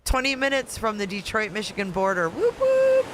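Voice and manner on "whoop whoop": high, loud sustained cheer; sing-out hooting cheer; celebratory whoop. Real, not acted. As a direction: whoop it up for home turf. Cheers for the Detroit border.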